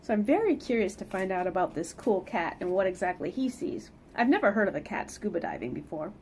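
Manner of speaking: ordinary delivery, nothing marked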